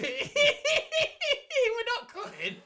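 squealing through laughter; high, slow laughing protest. Real, not acted.

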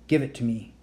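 usual speaking voice

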